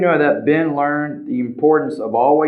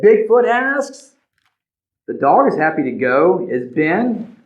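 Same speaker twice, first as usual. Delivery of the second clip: usual speaking voice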